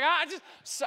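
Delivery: ordinary talk, nothing marked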